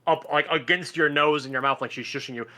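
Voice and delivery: ordinary talk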